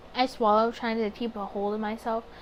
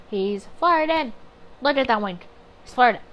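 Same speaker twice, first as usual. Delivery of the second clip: excited; 6 dB louder than usual. Real, not acted. Excited reaction to the wink.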